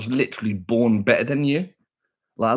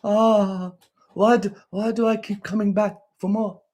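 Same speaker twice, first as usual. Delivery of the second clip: high, whiny-pleading mock voice; hammed-up helpless moan, pitched way up; mocking tormented haters; 10 semitones higher than usual. Streamer mimicking haters who keep coming back, high mock anguish. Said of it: "While they're going".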